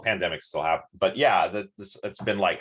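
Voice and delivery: ordinary talk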